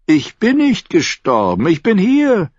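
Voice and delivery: leicht angedeuteter Tyrion, hier betont. Acted. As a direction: beruhigt sie mit Nachdruck. Tyrion antwortet Shay. (Tyrion leicht gesprochen, Betonung auf hier)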